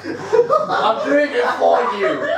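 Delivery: dramatic villain. Pretend. Villain declares his dream.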